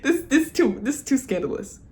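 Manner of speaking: high giggly protest; giggling. Retold or imitated. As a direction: laugh it off, flustered. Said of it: "she was like".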